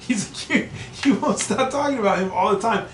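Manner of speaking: high, loud imitation of a stunned friend; incredulous mock outburst. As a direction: sputter in hammed-up disbelief, voice shooting up. Acts out friend's amazed reaction.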